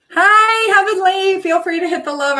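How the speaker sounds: high, loud, bright hello; excited greeting. Real, not acted. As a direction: greet a viewer with delight. Greeting viewer by name.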